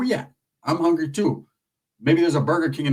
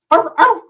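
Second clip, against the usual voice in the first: high loud little yaps; yappy chihuahua bark; 12 semitones higher, 6 dB louder than usual. Retold or imitated. Comic imitating the chihuahua's high yaps. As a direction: yap like a tiny dog.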